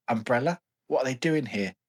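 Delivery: usual speaking voice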